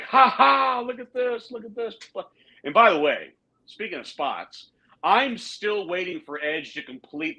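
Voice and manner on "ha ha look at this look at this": hammed-up villain laugh into taunt; mock maniacal laughing. Imitating Judgment Day laughing at the replay, mock maniacal glee. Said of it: "they're laughing maniacally, like".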